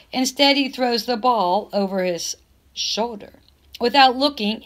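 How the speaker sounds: ordinary delivery, nothing marked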